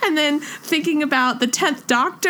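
ordinary talk, nothing marked

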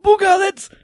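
high, loud crazy half-man outburst; Saw Gerrera. Acted. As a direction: blurt it like a crazed Forest Whitaker role. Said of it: "It's Saw Gerrera accent".

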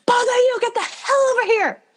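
high-pitched yell as the furious mother; acted angry mother's shout. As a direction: yell it up high like a mom summoning culprits. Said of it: "screaming my girlfriend's name at the top of her lungs and then shouting".

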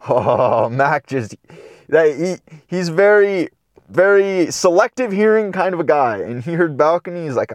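ordinary talk

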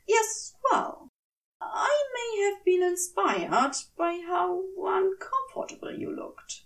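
a flustered angel fading to a hush; sheepish angel. A narrator's reading. Aziraphale's sheepish admission.

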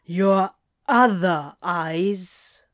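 very slow, irritated question; through gritted teeth. A narrator's reading. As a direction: grind out each word slowly. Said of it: "Crowley grit his teeth".

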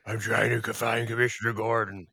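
slow, drawn-out superhero voice; Batman impression. Podcaster hunting for Commissioner Gordon, hammed-up Batman voice. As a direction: put on Batman, stretching the words. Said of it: "I was doing a Batman impression".

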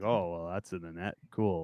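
deadpan. Retold or imitated. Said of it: "I was like".

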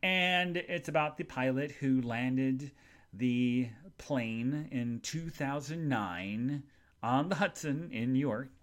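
ordinary talk

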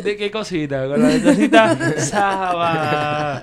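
high squeaky laughing voice. Real, not acted. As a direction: laugh through the words, high. Laughing over odd name.

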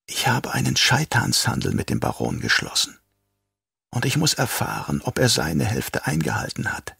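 soft, quietly fanatic Yui; lightly voiced traitor doctor. A narrator's reading. Yui explains his bargain.

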